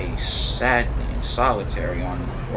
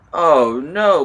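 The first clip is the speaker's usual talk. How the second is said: booming; 3 semitones higher, 7 dB louder than usual. Acted. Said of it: "boomed the Fat Controller".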